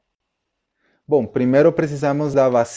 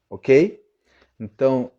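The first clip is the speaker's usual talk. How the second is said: ordinary talk, nothing marked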